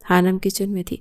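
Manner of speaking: plain reading voice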